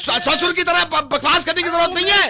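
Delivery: stammering, high-pitched furious yell; angry shouting. Acted. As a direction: trip over the words while yelling. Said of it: "मेरे से क्यों चीख रहे हो". (गुस्से में हकलाती चीख)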